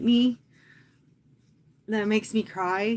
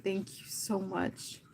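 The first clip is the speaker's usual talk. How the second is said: slow, much softer, a hint of the grieving brother; soft grieving thanks; 9 dB quieter, slower than usual. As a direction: quietly voice the brother's thanks, slowly.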